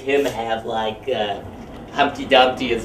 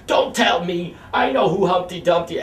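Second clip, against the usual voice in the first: raised, insistent retort; yelling back; 6 semitones higher than usual. Retold or imitated. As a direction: argue it back, not backing down. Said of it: "it turned into a yelling match".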